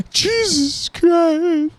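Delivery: high, very slow falsetto wail; mock despair. Groaning at his own joke, slow high mock wail. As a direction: stretch it out in a silly high whine.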